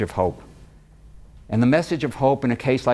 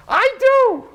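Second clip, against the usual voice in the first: high, loud eager yell; mother yelling; 5 dB louder than usual. Retold or imitated. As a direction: blurt it out like an eager mom in the crowd. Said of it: "This mother yells".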